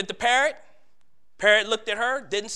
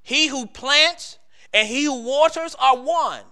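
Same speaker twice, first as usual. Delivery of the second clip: fervent scripture reading; 6 semitones higher, 5 dB louder than usual. Acted. Scripture read with preaching force.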